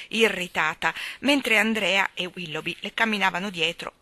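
plain reading voice

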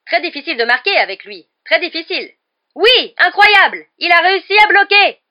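loud commentary rising to high, jubilant shouts; building excitement. A narrator's reading. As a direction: build suspense, then explode at the save. Commentary builds to a blocked penalty. (suspense puis explosion de joie)

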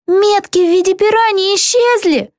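high-pitched announcing cry; calling out. A narrator's reading. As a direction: call the news up in a high voice. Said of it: "крикнула снизу какая-то русалка". (выкрик высоким голосом)

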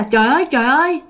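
high, mildly acted tearful wail; vừa khóc vừa nói. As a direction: lament as if crying. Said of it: "Vừa khóc vừa nói rằng á là". (giọng than khóc, lên cao)

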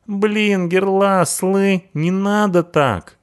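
hoge, smekende punkerstem, traag; frightened punk. Acted. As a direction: smeek om genade als de punker.

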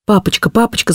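put-on crying out, pleading child. A narrator's reading. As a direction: beg Papa like a desperate child. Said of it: "закричала я, бросаясь на колени".

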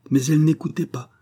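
plain reading voice

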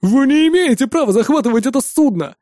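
high-pitched protest; indignant exclamation. A narrator's reading. Terrorist protesting against the hijacking, exclaiming. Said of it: "воскликнул один из террористов".

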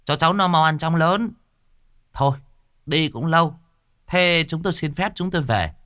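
polite guest taking leave; character voice. Acted. Narrator voices character dialogue. (giọng nhân vật lễ phép)

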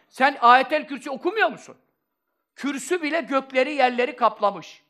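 loud, high-pitched, insistent sermon voice; full-force preaching. Real, not acted. Preacher challenging listeners on what the Throne is, full-force preaching. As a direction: drive each question home to the congregation.

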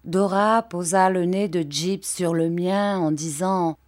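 usual speaking voice

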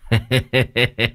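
short mocking chuckle. Uncle Sáu chuckles at scared Tâm, mocking laugh. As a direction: laugh at your own prank.

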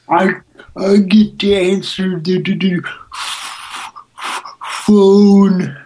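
high, stammering simpleton impression; Simple Jack. Mocking the operator who answers the phone, simpleton. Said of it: "Simple Jack".

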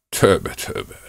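ordinary delivery, nothing marked

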